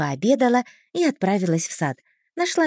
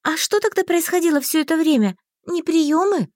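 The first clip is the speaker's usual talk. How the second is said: high-pitched worried questions; pitched-up, mildly panicky asking; alarmed; 7 semitones higher than usual. A narrator's reading. Heroine questions mages about receptions, alarmed. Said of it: "испугалась я".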